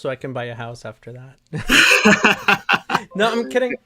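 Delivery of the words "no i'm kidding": laughing through it, high and loud; breaking up laughing at own joke; laughing while taking back the joke; real laughter. Real, not acted.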